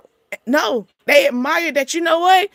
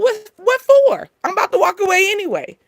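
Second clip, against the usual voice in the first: dismissive; 7 semitones higher than usual. Retold or imitated. Acted dismissive reply.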